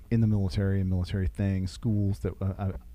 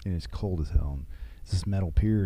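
ordinary delivery

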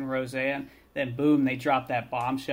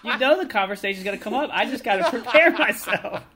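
higher and louder, laughing through the words; 9 semitones higher, 6 dB louder than usual. Real, not acted. Bob defending his chicken plan to friends, laughing. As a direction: crack up while defending yourself.

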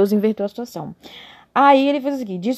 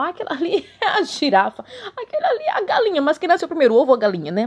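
high playful naming voice; 9 semitones higher, faster than usual. Pretend.

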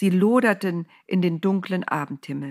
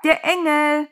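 high, loud drawn-out call; excited hailing cry; calling from afar; 9 semitones higher, 6 dB louder than usual. A narrator's reading. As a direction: shout the news across a distance. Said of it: "rief sie schon von Weitem".

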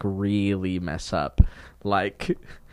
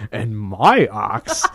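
dumb dwarf voice; much slower than usual. Retold or imitated. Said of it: "weaker dwarf who also popped in and was like".

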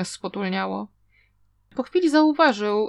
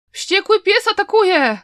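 high, loud, terrified cry; panicked scream; 9 semitones higher, 7 dB louder than usual. A narrator's reading. (przerażony krzyk)

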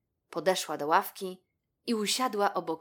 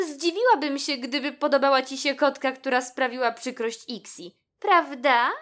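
high, loud, pointed questioning; lightly voiced animal friend; 8 semitones higher, 8 dB louder than usual. A narrator's reading. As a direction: press a friend for agreement, higher on the final word. Mona pointedly questions the rabbit. (wysoki, lekko zagrany głos przyjaciółki)

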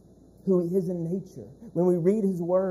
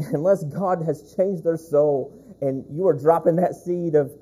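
louder, pounding pulpit delivery; full-force preaching; 7 dB louder than usual. Acted. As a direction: preach it hard to the congregation.

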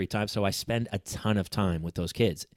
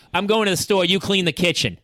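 higher, louder curt order; bossy tone; 8 semitones higher, 5 dB louder than usual. Retold or imitated. Husband imitating his wife's bossy order, raised and curt. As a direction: bark the chore like a command. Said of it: "it's her tone".

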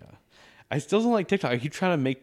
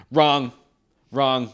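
loud, punchy wrong, wrong; mock cop scolding; 7 dB louder than usual. Cop scolding a driver, loud mock rebuke. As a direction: bark it like a cop.

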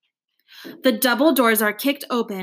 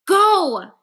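loud, high shout; 8 semitones higher, 6 dB louder than usual. A narrator's reading. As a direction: shout the order. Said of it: "I shout".

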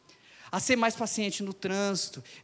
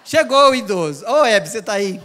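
loud, laughing call of the elder's arrival; mock announcing shout; 9 dB louder than usual. Preacher mocking the crowd, loud laughing call.

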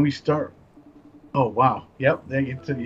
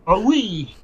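higher, playful French "Oh, oui"; mock French waiter voice; 6 semitones higher than usual. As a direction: play the fussy French waiter. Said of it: "That's what he sounds like".

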